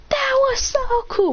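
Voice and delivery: high, slow, gushing wonder; thrilled. Acted. Boy shaking Mitsuki after the blast, awed squeal. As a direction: squeal it out in awe. Kid thrilled by lightning jutsu.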